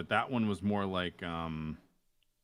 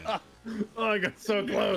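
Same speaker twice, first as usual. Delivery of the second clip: higher, louder oh I got so close; excited shout; 13 semitones higher, 5 dB louder than usual. Real, not acted. Reacting to replay.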